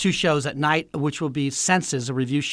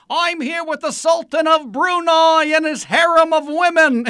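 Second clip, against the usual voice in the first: high, hollering TV host impression; Robin Leach; 11 semitones higher, 3 dB louder than usual. Impressionist recalling a TV host's report, high hollering impression. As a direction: proclaim it way up high. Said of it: "so used to seeing him".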